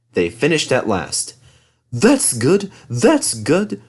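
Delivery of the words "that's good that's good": higher contented puff. Acted. Thomas sets off satisfied, higher puff. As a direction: puff it happily. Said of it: "puffed Thomas".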